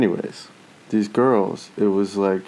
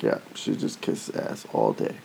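whispered, lower and much softer; wife's hushed aside; 11 semitones lower, 8 dB quieter than usual. Retold or imitated. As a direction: whisper it like a confided dig. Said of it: "She's like".

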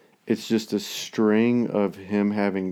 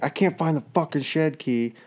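mock frustration; 7 semitones higher than usual. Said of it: "I was like".

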